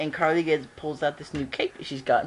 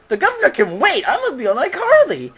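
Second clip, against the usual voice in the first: higher, louder mock excited exclaim; excited outburst; 10 semitones higher, 6 dB louder than usual. Obama choosing iCarly over governor, excited. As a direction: burst out like it's the best news ever.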